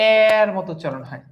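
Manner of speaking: এর said "æær", stretched and slow; open vowel demo. Shown as a demonstration. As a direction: draw out the first vowel of এর as a long æ. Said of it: "এর বিবৃত উচ্চারণ হচ্ছে".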